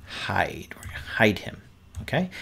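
hushed whisper. Acted. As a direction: whisper it like a secret. Hiding the bear, playful.

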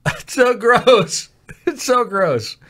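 high, disgusted whine; grossed out. Real, not acted. Player repelled by the sticky glass, grossed out. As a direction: recoil from it, pitch jumping up.

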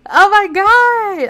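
high, loud, drawn-out amazement; thrilled exclamation. Real, not acted. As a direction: gush it loudly after a scream. Thrilled reaction after screaming.

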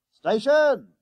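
high, loud conductor's yell. A narrator's reading. Conductor anxious to be helpful, yelling down the bus. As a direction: bellow the stop down the bus. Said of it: "he yelled down the bus".

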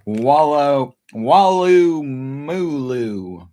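loud, slow, drawn-out vowels; playful place-name call-out. Shown as a demonstration. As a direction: stretch out the silly name loudly. Showing off Australian place name.

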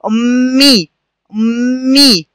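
"أمي" with a long hummed m and clear final ee; doubled m demonstration. Shown as a demonstration. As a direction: hold the double m in "أمي" for the learners. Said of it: "هكذا".

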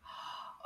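soft playful gasp; gasp of discovery. Pretend. Teacher finding another word for learners, playful gasp. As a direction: gasp as if spotting something new.

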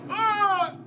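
high loud yelp; startled shout. Real, not acted. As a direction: jump in fright. Sudden scare.

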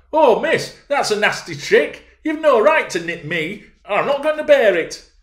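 high, loud indignant outcry; shrill-pitched shout of complaint; screamed protest. Acted. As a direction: scream it out at her, outraged. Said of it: "screamed out".

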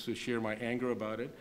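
usual speaking voice

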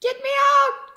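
high, loud panicked plea; gasped. Acted. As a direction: beg to be freed. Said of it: "gasped Percy".

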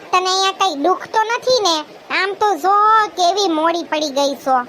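ordinary talk, nothing marked

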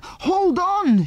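very high shout, half afraid; alarmed shout. A narrator's reading. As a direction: shout a desperate warning, pitched right up. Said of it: "shouted Andy, half afraid himself".

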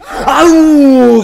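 sudden loud high "ow"; pained yelp. Real, not acted. Hurt by a game jump scare.

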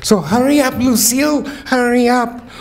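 high, urgent calling out. Acted. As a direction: hurry her along, voice up high. Poem voice calls to Lucille.

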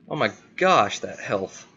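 ordinary delivery

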